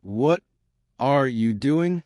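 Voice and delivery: halting learner's delivery; broken and slow. Shown as a demonstration. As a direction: break it up after "What". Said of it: "Broken, slow".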